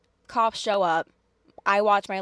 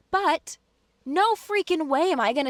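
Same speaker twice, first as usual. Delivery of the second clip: rising, emphatic refusal; real indignation; 8 semitones higher, 3 dB louder than usual. Real, not acted. Tenant refusing to hear abused girl screamed at, indignant. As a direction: push up the pitch in heated disbelief. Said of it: "my blood is boiling again".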